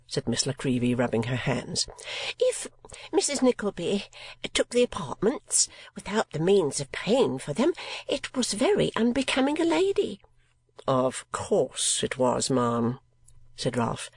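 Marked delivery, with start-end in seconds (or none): 2.4-14.1 high, affected spinster voice with a prim throat-clear; mincing lady of fifty. Acted. Miss La Creevy pleads to Ralph she cannot lose money, high and mincing. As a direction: play the put-upon unprotected female. Landlady complains about unpaid rent.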